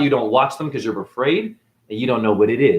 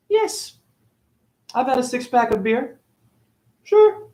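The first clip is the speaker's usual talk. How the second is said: much higher, sing-song yes and sure; mock cheerful admission; 12 semitones higher than usual. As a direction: chirp it like it is nothing. Mock-cheerful admitting beer.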